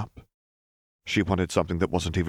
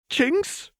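worried cry. Acted. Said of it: "Lux cried".

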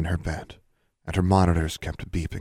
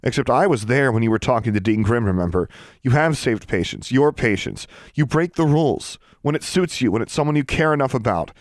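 fast start, high, raised-voice tirade; heated, insistent outburst; accusing; 6 semitones higher, 5 dB louder than usual. A narrator's reading. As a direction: argue hotly, building in pitch.

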